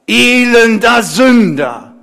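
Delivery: slow, full-force shout of abuse; lautes Schreien. Acted. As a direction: shout it out, high and drawn out. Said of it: "schrien noch lauter". (laut geschrien)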